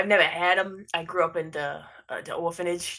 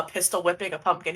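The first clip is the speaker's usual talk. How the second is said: ordinary talk, nothing marked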